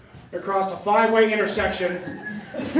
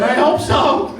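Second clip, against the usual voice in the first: laughing; 6 semitones higher, 6 dB louder, slower than usual. Acted. Laughing reply to the leaf.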